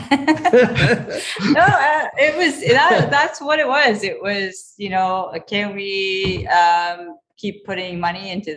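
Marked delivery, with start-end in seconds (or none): 0.1-4.0 laughing through the words. Real, not acted. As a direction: chuckle while answering. Laughing while answering a question.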